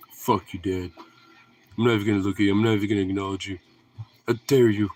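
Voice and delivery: low, sulky dog voice; lightly voiced huffy dog; voicing the dog. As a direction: play the offended dog snubbing dad. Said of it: "He's just like".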